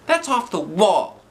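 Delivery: high, slow put-on voice; teacher character voice. A narrator's reading. Teacher scoffing at the plan, high slow voice.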